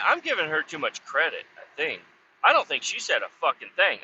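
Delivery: ordinary talk, nothing marked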